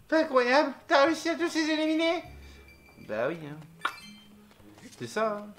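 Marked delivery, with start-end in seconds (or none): 0.1-2.2 high, loud hammed-up amazement; acted amazement. Game creature marvels at hero's victory, high amazed voice. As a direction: gush in a high character voice.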